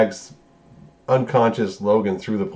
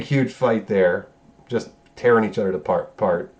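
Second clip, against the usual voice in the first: ordinary talk, nothing marked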